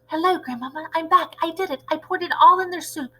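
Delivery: put-on high, eager boy mouse voice; excited boy. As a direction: tell Grandmama proudly.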